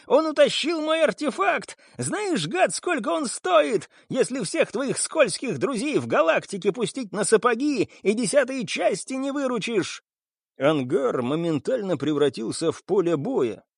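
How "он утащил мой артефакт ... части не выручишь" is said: high, hysterical raving boss; frantic yelling. A narrator's reading. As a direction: rant at the top of your voice. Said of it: "вопил Кирби".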